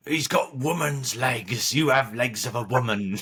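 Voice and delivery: a hint of a Blackadder yokel; Blackadder impression. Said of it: "The, the guy from Blackadder".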